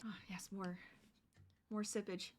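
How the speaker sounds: soft whispered aside. Real, not acted. Whispers while grabbing drink.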